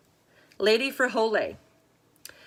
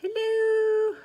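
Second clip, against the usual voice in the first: high call-out hello; 14 semitones higher than usual. Real, not acted. Testing mic, high call.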